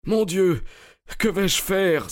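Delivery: high-pitched, desperate exclamation; despairing cry. A narrator's reading. Stranger learning the cart was empty, despairing cry. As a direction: cry out at your wits' end. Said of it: "s'écria l'inconnu au comble du désespoir".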